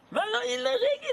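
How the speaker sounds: "illogique" cried out very high and fast; "illogique" in a sharp high mocking cry; incredulous exclaim. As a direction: fling "illogique" up high like an absurd idea. Repeating the word in disbelief.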